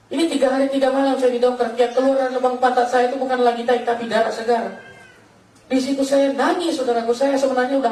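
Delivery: preaching fervently about suffering; impassioned preaching. Real, not acted. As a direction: testify with rising urgency. Impassioned testimony, pitch raised.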